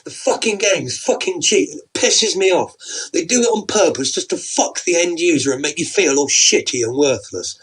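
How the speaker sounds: angry rant. Acted. Husband rants about the game.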